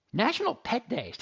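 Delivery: sudden realization, pitch jumps up; surprised discovery. Real, not acted. Host discovering the holiday on air, surprised. Did no one tell me this?.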